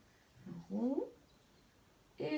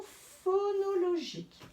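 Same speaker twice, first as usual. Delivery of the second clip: "phonologique" high and stretched, syllable by syllable; teacher spelling out "phonologique" by ear; stressed key term; 8 semitones higher than usual. Shown as a demonstration. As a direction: lift and lengthen "phonologique" to make it stand out.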